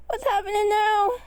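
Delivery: high, loud frightened cry; panicked yell. A narrator's reading. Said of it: "yelled Lucky".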